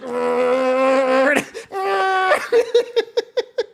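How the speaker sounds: high, loud helpless shriek of laughter; loud laughing howl. Real, not acted. Recalling the netting straining, loud shrieking laughter. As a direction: break down laughing at the memory.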